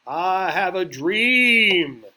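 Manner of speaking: soaring, booming preacher-orator, very slow; Martin Luther King. Retold or imitated. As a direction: stretch each word out loud and high. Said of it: "Martin Luther King, the old".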